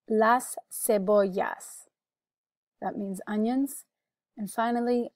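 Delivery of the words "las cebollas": "Las cebollas" said slowly, words apart; slow, deliberate "Las cebollas" for learners; careful pronunciation model. Shown as a demonstration. Teacher gives the word for onions, slow careful model. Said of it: "You can repeat for pronunciation".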